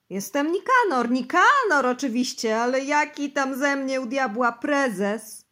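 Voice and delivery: loud, high, frantic reply; wild laughing outburst. Acted. Bosy denies he is chairman, loud and frantic. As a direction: blurt it out like a man coming unhinged. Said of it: "roześmiał się tak straszliwym śmiechem".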